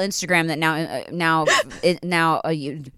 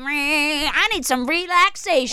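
mock rock star; 9 semitones higher, slower than usual. Rock star wanting relaxation.

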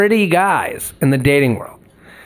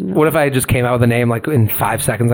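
ordinary delivery

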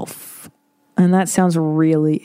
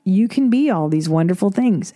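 ordinary talk

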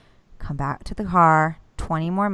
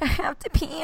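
whiny high; 11 semitones higher than usual. Retold or imitated. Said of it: "And I'm like".

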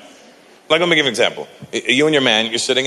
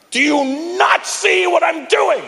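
angry daughter. Retold or imitated. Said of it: "she got angry".

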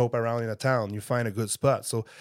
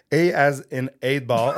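A as in eight ball, lento y con risa; slow careful spelling; 3 semitones higher, 5 dB louder than usual. Shown as a demonstration. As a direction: deletrea con cuidado, entre risas. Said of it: "The next one".

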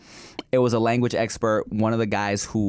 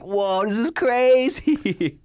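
amazed; 13 semitones higher, 4 dB louder, much slower than usual. Retold or imitated. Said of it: "And it's like".

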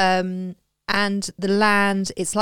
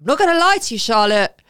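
emphatic; 6 dB louder than usual. Retold or imitated. Retelling her blunt answer.

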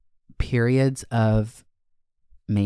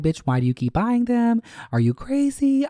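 self-scolding; 11 semitones higher than usual. Retold or imitated. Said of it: "But then it's like".

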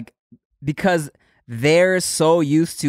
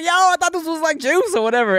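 protesting; 11 semitones higher, faster than usual. Retold or imitated. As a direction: imitate a flustered excuse. Acts out own skit line.

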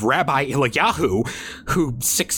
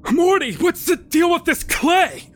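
stern father; 13 semitones higher than usual. Acted. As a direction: call out like an annoyed dad. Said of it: "my father asked me".

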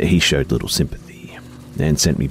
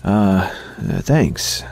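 nervous stammer; 5 semitones higher, much slower than usual. Acted. Nervous boy, skin crawling.

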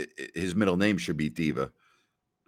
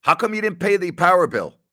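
much higher and louder incredulous call; mock exasperated question; 10 semitones higher, 7 dB louder than usual. Teammate confronting Jason about the bill, mock-incredulous shout. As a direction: demand an answer, hammed up. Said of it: "I said".

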